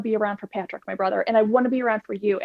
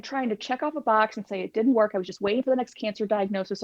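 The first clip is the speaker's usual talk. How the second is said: normal talking voice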